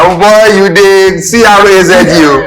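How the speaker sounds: high, loud, slow pidgin hailing with a laugh; laughing Naija banter. Retold or imitated. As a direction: tease a mate, laughing. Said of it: "when you greet".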